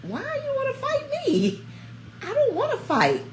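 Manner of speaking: put-on pleading, scared kid. Middle-school her begging a girl not to fight, high laughing plea. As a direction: squeak out a frightened why with a laugh. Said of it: "and I'm like".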